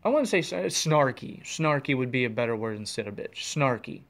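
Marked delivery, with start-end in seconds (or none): none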